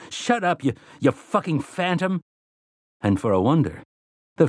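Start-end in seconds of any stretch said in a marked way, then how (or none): 0.1-2.2 high-pitched furious yell; screaming. Acted. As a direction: keep screaming at the phantom. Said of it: "Eddie screamed into the darkness".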